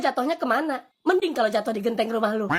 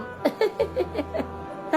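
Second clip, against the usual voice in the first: breathy giggle; 6 semitones higher, 4 dB quieter than usual. Real, not acted. Reacting to joke.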